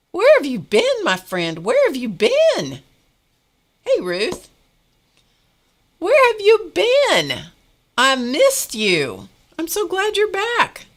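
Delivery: high, loud, drawn-out call of a friend's name; delighted greeting. Real, not acted. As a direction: sing her name out in delight. Greeting a returning live viewer.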